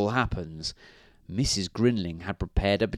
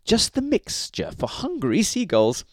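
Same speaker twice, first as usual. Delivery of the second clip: very high, pleased wifely voice; high-pitched eager scheming; exclaimed; 12 semitones higher than usual. A narrator's reading. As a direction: announce the idea in a woman's voice. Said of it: "she exclaimed".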